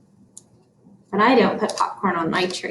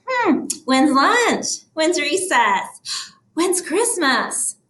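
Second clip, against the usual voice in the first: higher, slow wandering questions; distracted voice; 6 semitones higher, slower than usual. Shown as a demonstration. Teacher acts out losing focus on the book, distracted voice. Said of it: "That's the distracting voice".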